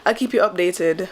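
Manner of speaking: normal talking voice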